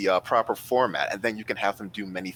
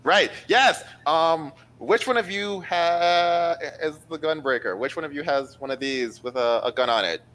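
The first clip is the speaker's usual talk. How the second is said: perky high voice; 6 semitones higher than usual. Acted. Uso snaps back, addressing class.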